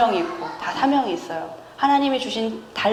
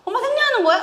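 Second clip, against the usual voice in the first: put-on high kid voice; child asking; 12 semitones higher, faster than usual. Younger child asks mom too, high and innocent. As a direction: ask innocently like a small child. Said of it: "이러면서 정원이도".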